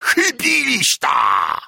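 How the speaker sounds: high, slow, loud roaring cry; hoarse roar. A narrator's reading. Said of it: "zagrzmiał chrapliwy ryk Bartka". (chrapliwy, przeciągły ryk)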